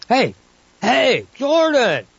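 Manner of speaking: high shout across distance; calling out. Acted. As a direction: yell down for the assistant. Calling assistant from tower.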